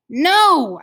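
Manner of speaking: loud, high yell of protest; screamed. A narrator's reading. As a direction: yell it at him, short of a full scream. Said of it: "I screamed at him".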